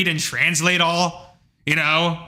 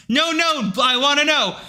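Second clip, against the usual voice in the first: insistent cashier; 10 semitones higher than usual. Retold or imitated. Cashier insisting on hearing name.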